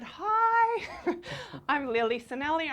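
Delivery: high, loud, squeaky-high greeting; nervous hello. Retold or imitated. Audience member standing to greet Oprah, high nervous hello. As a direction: pitch the hello way up. Acting out her nervous introduction.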